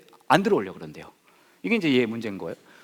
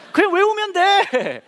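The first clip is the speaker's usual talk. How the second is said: mock insistent; 8 dB louder, much slower than usual. Urging chant.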